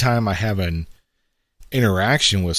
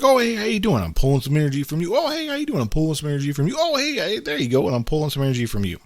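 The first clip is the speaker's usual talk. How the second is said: much higher, fast, chirpy schmoozer voice; mock-friendly networking vampire greeting; energy vampire; 8 semitones higher, faster than usual. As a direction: play a cheery vampire draining everyone. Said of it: "I call these the vampires".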